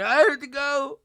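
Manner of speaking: high-pitched put-on wail; hammed-up tearful exit line; imitated sobby whine; mock crying. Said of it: "she's, like, crying".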